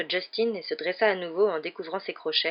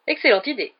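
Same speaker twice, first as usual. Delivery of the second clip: loud, higher-pitched agreement; hearty approval; 6 semitones higher, 6 dB louder than usual. A narrator's reading. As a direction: approve with enthusiasm. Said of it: "approuva Lockhart". (approbation enthousiaste)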